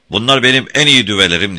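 proud roar, loud and high; Beşvayo. A narrator's reading. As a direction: roar it out quickly. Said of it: "diye kükredi". (gururla kükreyerek)

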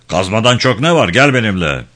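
brusque sergeant. A narrator's reading. Sergeant brusquely orders the boy along.

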